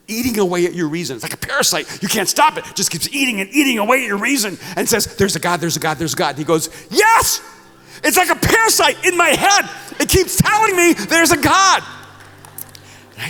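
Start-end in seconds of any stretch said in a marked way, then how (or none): 0.1-6.3 loud, high, relentless preaching; mounting, urgent argument; building intensity. Retold or imitated. Building argument about the parasite. 6.9-11.8 very high, full-force acted shout; desperate yell at the top of the voice; exploding in frustration. Atheist erupts in acted confession.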